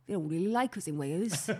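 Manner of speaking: sing-song put-on Welsh; Welsh accent. Host recalling living in Wales, in a Welsh accent. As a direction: keep up the Welsh lilt. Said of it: "That's a good Welsh".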